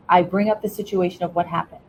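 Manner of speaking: ordinary talk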